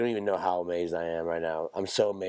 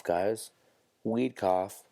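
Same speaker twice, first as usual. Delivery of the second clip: ordinary delivery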